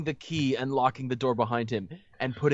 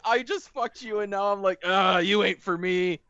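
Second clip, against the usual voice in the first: mock douchebag voice; 7 semitones higher than usual. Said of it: "he's just like".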